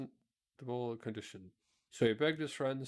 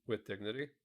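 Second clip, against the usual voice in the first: ordinary delivery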